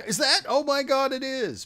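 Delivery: high, loud excited gasp; sudden thrilled recognition; surprised recognition. Retold or imitated. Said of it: "There was a couple where I was like".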